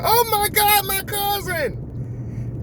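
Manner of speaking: very high, drawn-out falsetto squeal; excited cousin. Retold or imitated. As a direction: mimic a cousin thrilled to see family. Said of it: "she's so excited to see me".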